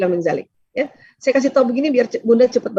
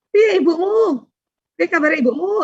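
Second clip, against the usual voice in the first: put-on bright, much higher friendly hello to a child; cheery greeting; 8 semitones higher than usual. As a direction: greet the neighbour's kid with a big smile, voice up high. Greeting neighbour's child warmly.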